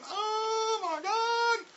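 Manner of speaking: very high, drawn-out squeal; hammed-up horrified squeak; mock dismay. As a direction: stretch it into a high, thin mock wail. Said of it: "I'm going".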